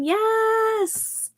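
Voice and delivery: a sudden high odd accented "Yes"; weird accent. Real, not acted. Streamer cheering a viewer's update, odd accent. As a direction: blurt a quirky accented yes. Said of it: "I said that in a really weird accent".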